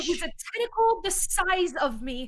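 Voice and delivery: high-pitched urgent protest; alarmed warning. Acted. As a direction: insist urgently, voice climbing with alarm. Warns friend off the tentacled water.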